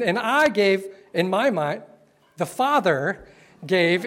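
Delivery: ordinary delivery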